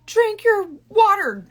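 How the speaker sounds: high, slow barked order; drill sergeant. Pretend. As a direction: bark it like a drill instructor. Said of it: "a drill instructor".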